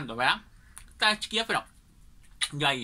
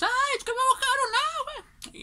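shrill shriek, much higher; kids screaming. Retold or imitated. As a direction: squeal in shock like doused kids. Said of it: "ya que gritan todos". (grito agudo de niños asustados)

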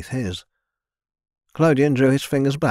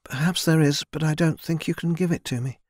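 put-on higher, timid young woman's voice; young servant girl; 6 semitones higher than usual. A narrator's reading. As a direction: voice a shy girl speaking up to her lord. Narrator voices Mina.

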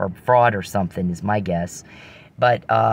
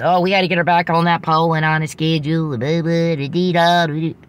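higher and louder hammed-up hillbilly voice; mock hillbilly accent; 6 semitones higher, 4 dB louder than usual. Mocking the guy who wants her back on the pole, in a hammed-up hillbilly accent. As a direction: parody the guy's hillbilly accent, trailing into blah-blah. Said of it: "country bumpkin talk".

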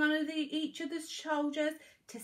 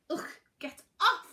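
raised, higher annoyed outburst; annoyed cry; 6 semitones higher, 6 dB louder than usual. A narrator's reading. Girl to the dog squatting on her head, annoyed cry. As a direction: shove it away with your voice.

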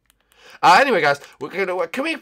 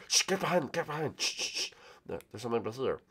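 soft, hushed warning; urgent hushing; 4 semitones lower, 13 dB quieter, faster than usual. Pretend. Warning the guys there's people here, urgent hushing. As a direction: shush them and keep the voice down. Hiding from people they spotted.

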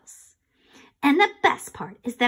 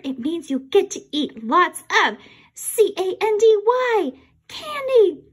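ordinary delivery

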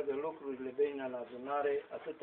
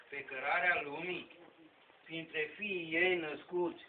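solemn verse declaimed in measured phrases; poem recitation; 7 dB louder than usual. Acted. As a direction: declaim sacred poetry, pausing between the lines.

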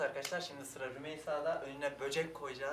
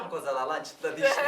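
excited; 12 dB louder than usual. Real, not acted. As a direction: shout it out with glee. Excited about the answer. (heyecanla bağırma)

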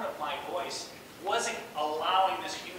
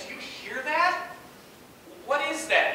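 higher, pointed questioning; retold challenge; 9 semitones higher, 4 dB louder than usual. Interviewer confronting the candidate about his voice, pointed and higher. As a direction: challenge him, pitched up and insistent. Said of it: "He's like".

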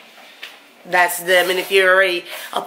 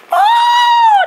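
a very high, loud startled Oh; sudden shout; 6 dB louder than usual. Real, not acted. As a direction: blurt it out in sudden alarm. Shouts at building door.

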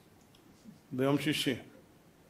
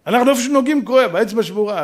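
ordinary talk

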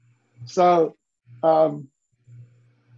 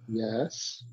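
sheepish admission; 7 semitones lower, 12 dB quieter than usual. Retold or imitated. Client sheepishly admitting.

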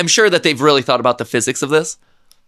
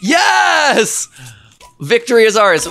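triumphant cheer; 7 semitones higher, slower than usual. Real, not acted. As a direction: cheer the win. Cheering a win.

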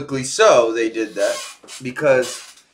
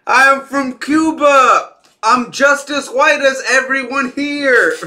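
louder, higher and slow, a hammed-up proud shout; mock proud declaration; 10 semitones higher, 6 dB louder, slower than usual. Host mockingly repeats a cast member's line, hammed-up loud proclamation.

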